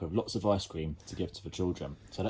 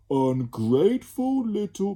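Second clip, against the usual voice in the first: slow, loud, exasperated creature voice; indignant grumble; 13 semitones higher, 9 dB louder, slower than usual. Acted. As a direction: Slime losing patience. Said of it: "began Slime".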